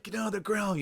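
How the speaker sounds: high-pitched imitated police order; cop's command. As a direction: act out a cop ordering a suspect down. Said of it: "and they're just like".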